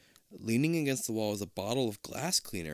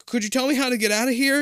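panicked plea; 12 semitones higher, 8 dB louder, faster than usual. Acted. Trapped hero pleading.

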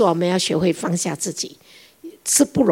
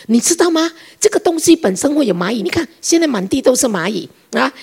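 angry scolding; 5 semitones higher than usual. Retold or imitated. Mother-in-law scolding daughter-in-law over ants, angry. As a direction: lecture her hotly about the ants. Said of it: "我一下子就火起来了".

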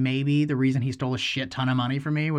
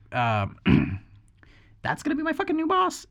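high, disbelieving squeak; mock-shocked disbelief at the new boss; incredulous; 13 semitones higher than usual. Retelling his shocked reaction to the tweaker buyer.

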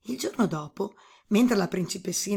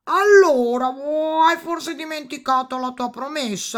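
high, loud toad with croaks; reproachful toad; 6 semitones higher, 9 dB louder than usual. A narrator's reading. Toad reproaching the princess over her promise, high croaky voice.

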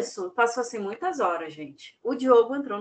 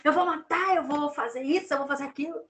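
retold yelling; 7 semitones higher than usual. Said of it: "ela começou a gritar".

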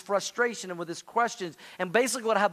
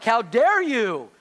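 slow, louder, mock-offended; mock indignant God; 4 semitones higher, 6 dB louder, much slower than usual. Preacher imagining God scolding a doubter, mock indignant. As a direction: play God as theatrically offended. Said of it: "Is God gonna be like".

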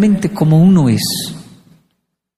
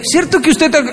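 high-pitched mock wifely questioning; accusing wife; 10 semitones higher than usual. Wife questions husband, high-pitched mock accusation. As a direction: put on a wife's high voice, pressing him.

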